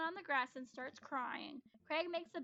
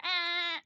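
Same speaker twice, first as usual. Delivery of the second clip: shriek; 4 semitones higher, 11 dB louder than usual. Acted. Breaks into a shriek.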